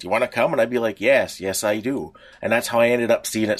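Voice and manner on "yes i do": ordinary delivery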